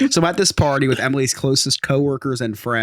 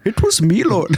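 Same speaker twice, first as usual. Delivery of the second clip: higher-pitched mock Middle Eastern accent; jokey Middle Eastern impression; 7 semitones higher than usual. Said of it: "Middle Eastern".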